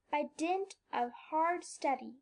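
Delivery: ordinary delivery, nothing marked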